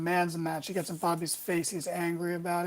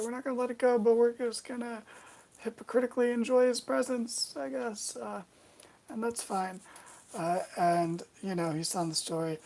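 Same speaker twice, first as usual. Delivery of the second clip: ordinary talk, nothing marked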